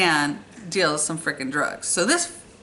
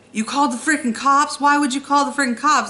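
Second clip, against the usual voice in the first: angry disbelief; 7 semitones higher, 3 dB louder than usual. Retold or imitated. Man furious about police call.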